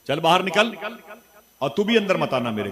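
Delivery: high, sharp, shouted scolding; angry scolding wife. Acted. Wife shouts at husband to get out of her house, angrily. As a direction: throw him out of the house with a yell. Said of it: "उसने डांट के कहा".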